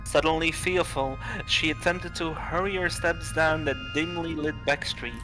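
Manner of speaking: ordinary delivery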